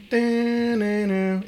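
high, slow sung imitation of a sample; hummed beat hook. Fan imitating the song's little hook, sung high and slow. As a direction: hum the riff up high and stretched.

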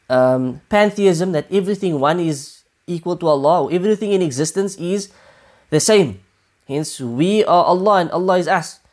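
ordinary talk, nothing marked

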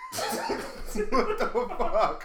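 slow, stretched, through laughter; laughing while talking. Real, not acted. Laughing disbelief.

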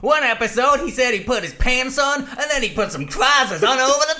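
hammed-up broad American accent. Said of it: "broad, probably offensive, uh, American accent".

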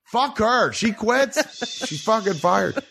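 high, strained yelled rant; mock furious rant. Husband imitating himself firing his wife, yelling. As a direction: rage it out half in jest. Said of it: "And I was like".

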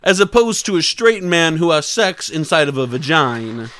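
drawn-out caricature voice; slow down on the key words, in character; hammed-up impression. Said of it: "all these impressions going on".

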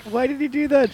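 high baffled mock question. Asking the friend about her boyfriend, high mock bafflement. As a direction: squeak it out in disbelief. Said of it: "It's like".

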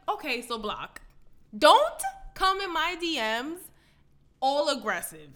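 loud, slow, exasperated telling-off; fed-up retort. Retold or imitated. Telling off a pushy guy in her DMs, loud exasperated retort. Retold reply to pushy DMer.

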